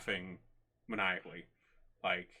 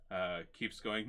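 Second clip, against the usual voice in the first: normal talking voice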